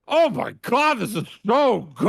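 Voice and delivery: high, loud, delighted. Retold or imitated. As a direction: savor it with delight. Imitated delight, high far.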